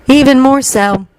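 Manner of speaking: solemn and certain; grave. A narrator's reading. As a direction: pronounce it gravely. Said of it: "Luna shook her head gravely".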